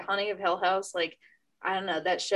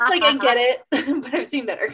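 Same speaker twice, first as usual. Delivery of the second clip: laughing; 6 semitones higher, 9 dB louder, faster than usual. Real, not acted. Repeats verdict laughing.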